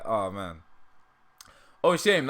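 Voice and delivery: ordinary delivery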